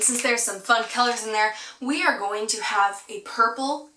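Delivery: ordinary talk, nothing marked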